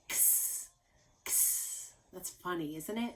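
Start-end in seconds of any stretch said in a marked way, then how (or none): 0.1-1.9 X as a breathy whispered ks; voiceless ks hiss for X; X sound. Shown as a demonstration. Teacher showing children the X sound, a whispered ks. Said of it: "The sound of X is X".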